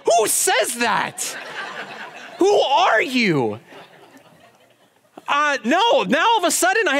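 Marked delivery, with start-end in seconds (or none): 0.0-3.6 stunned disbelief. Retold or imitated. Stunned by stranger's answer.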